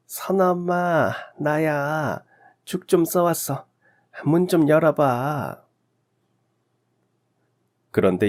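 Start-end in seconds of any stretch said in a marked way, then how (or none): 0.1-5.6 higher, drawn-out call through a door; neighbour calling out. A narrator's reading. Neighbour calls at the friend's door, high drawn-out calling. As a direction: call out to a friend inside, stretching the words.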